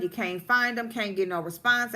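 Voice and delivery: ordinary talk, nothing marked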